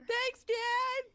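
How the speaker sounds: squeaky cheerful shout; high happy shout. Pretend. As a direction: call it out high and bright. Player shouts thanks to dad.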